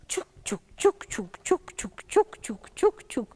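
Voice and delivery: short, separate chuk sounds; train chugging. Acted. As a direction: imitate a train's steady puffing. Imitating the train.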